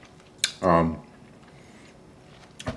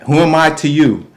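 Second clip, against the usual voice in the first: lightly mimicked question, higher and louder; imitating a needy boyfriend; 7 semitones higher, 5 dB louder than usual. Man asking where he stands with her, imitating insecurity.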